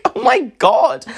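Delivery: high, loud Oh my God; outraged disbelief. Retold or imitated. Telling the ex off, high appalled disbelief. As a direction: gasp it out, appalled.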